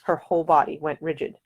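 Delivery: plain reading voice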